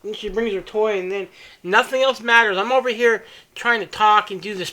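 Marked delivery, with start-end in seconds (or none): none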